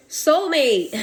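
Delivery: excited exclamation. Real, not acted. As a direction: call out the discovery. Reveals the card that fell.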